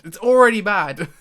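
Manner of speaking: emphatic, loud protest at a bad deal; loud dismay. Real, not acted. Repeats the verdict with rising force.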